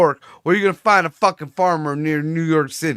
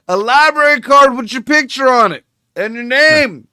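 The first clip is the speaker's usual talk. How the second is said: higher and louder, in disbelief; incredulous outburst; 6 semitones higher, 6 dB louder than usual. Real, not acted. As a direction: hammer home how absurd it is. Incredulous rant about library card.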